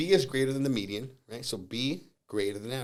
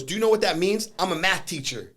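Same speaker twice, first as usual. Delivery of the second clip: higher and louder, mocking challenge; imitating a smug teacher; 7 semitones higher, 6 dB louder than usual. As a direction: lord it over him like a know-it-all. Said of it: "He goes".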